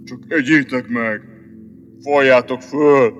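deep, funereal lion; low, mournful tomb voice; sepulchral voice. Acted. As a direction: order it from beyond the grave, very low. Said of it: "mondta síri hangon Bruckner Siegfried".

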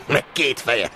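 high, laughed-through words; mocking laughter. Stationmaster joking about the gawked-at dispatcher, mocking laughter. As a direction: say it through a scornful chuckle. Chief laughing at his own jibe.

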